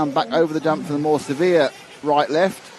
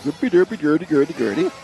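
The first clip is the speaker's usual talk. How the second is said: Muppet Swedish chef gibberish; mock Scandinavian babble. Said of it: "That's my, uh, Norwegian accent".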